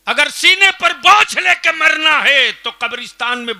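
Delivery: high, loud harangue; thundering challenge. Real, not acted. Preacher challenging the village elders, full-force shout.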